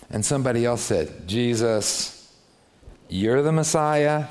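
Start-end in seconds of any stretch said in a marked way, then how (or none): 1.3-4.2 sarcastic. Shown as a demonstration. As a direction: sneer it as if you don't believe it. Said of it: "It means the exact opposite thing".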